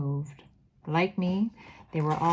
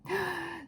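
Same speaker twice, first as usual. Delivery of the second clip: fearful gasp; 12 semitones higher, 6 dB quieter than usual. Acted. Audience witch gasps in fear.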